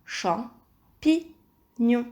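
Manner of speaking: Champignon. very slowly split in three; long gaps between syllables of Champignon. Shown as a demonstration. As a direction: repeat "Champignon." as cham, pi, gnon. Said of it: "à la troisième syllabe".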